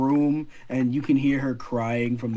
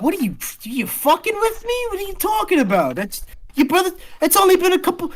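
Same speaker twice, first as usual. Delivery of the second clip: very high, panicked outburst; shocked disbelief; 5 dB louder than usual. Acted. Girl hearing friend's brothers are dead, panicked. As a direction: voice shooting up, refusing to believe it.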